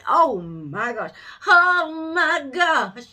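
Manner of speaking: slow it down, overcome; overwhelmed. Real, not acted. Reader overwhelmed by the spread, stretched oh my gosh.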